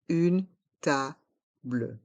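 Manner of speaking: "Une table" split into separate slow syllables; slow model pronunciation. Shown as a demonstration. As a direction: pause between syllables of "Une table", sounding the final -ble. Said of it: "Prononçons".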